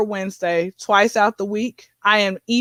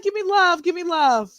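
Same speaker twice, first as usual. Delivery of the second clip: effusive greeting; 6 semitones higher than usual. Acted. Acts out greeting a fan.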